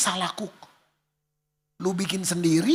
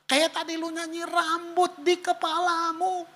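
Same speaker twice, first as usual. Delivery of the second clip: crying prayer; 7 semitones higher than usual. Retold or imitated. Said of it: "saya duduk berapa detik nangisnya".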